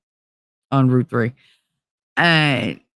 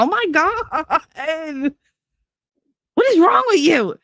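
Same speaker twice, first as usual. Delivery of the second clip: drawn-out, pitched-up disbelief; exasperated; 14 semitones higher, slower than usual. Retold or imitated. To a pushy friend, high incredulous exasperation. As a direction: ask what's wrong with him, incredulous.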